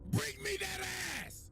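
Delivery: high, shouted, reckless challenge; villain shout. Retold or imitated. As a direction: yell it, ready to brawl. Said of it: "So Dio's like".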